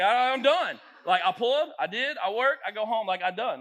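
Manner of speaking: mock caveman, punched out loudly. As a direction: grunt it out in blunt caveman bursts. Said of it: "Like, caveman".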